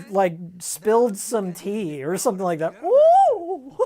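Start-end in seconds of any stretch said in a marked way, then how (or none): 2.8-3.8 very high, loud prim "Oh!"; a shocked old British lady spilling tea; old British lady yelp. Retold or imitated. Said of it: "like some old British woman".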